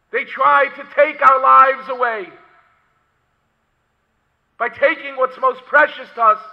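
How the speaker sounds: loud, high-pitched sermon delivery; impassioned preaching. Acted. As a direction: preach at full force, punching key words. Preaching at full force.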